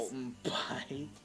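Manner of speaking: breathy slow whisper of a secret. Acted. Teasing co-host with a whispered aside. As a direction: hush it like a spooky secret. Playful aside about Colton art.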